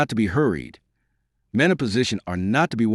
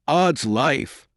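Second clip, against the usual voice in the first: angry cry; 5 semitones higher than usual. A narrator's reading. Grey outraged at Wilding's advice, angry cry. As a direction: burst out in indignation. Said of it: "cried Grey".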